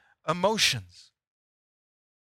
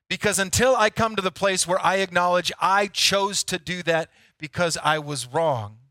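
normal talking voice

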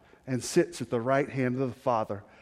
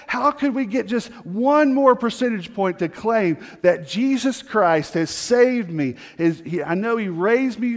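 louder, ringing pulpit voice, pitched higher; preaching at full force; 5 semitones higher, 5 dB louder than usual. Acted. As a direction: drive the challenge home to the congregation. Preaches the one percent vision.

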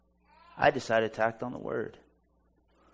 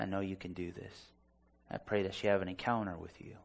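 quiet prayer; 3 semitones lower, 7 dB quieter than usual. Retold or imitated. Retold prayer over the woman.

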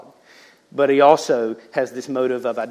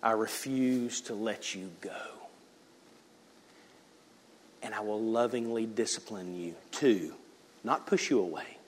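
slow, much softer, reassuring; soft tender promise; 6 semitones lower, 12 dB quieter than usual. Acted. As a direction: speak the promise gently, drawing them close. God promises not to let go.

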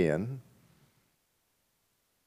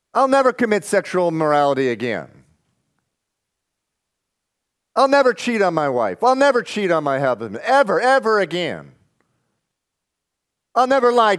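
higher, preachy caricature of boastful promises; mock pious vow; 8 semitones higher than usual. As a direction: parody the self-sure believer. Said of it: "You know what that sounds like?".

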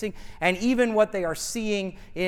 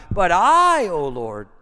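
drawn-out, forceful "I", then drop on "O Lord"; stress on "I"; 7 dB louder than usual. Acted. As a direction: hold and punch "I" to mark the contrast. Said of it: "see the contrast here".

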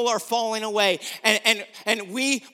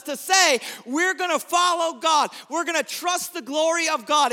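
preaching at full force; 6 semitones higher than usual. Acted. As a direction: rouse them like a war speech.

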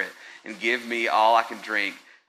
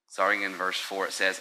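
normal talking voice

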